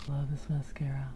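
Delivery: soft breathy whisper; quiet whispered aside about the mascara. Real, not acted. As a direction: whisper it like a secret.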